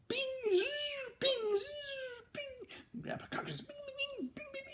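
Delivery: very high ringing bings; ping each stinger over; hammer pings. Acted.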